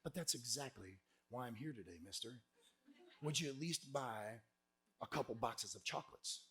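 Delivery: soft, low, earnest plea; hushed imitation of a pleading young seller; emotional kid's pitch. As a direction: drop your voice and tie emotion into the ask. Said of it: "he's tying the emotion into it".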